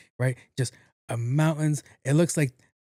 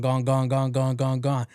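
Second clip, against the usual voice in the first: ordinary talk, nothing marked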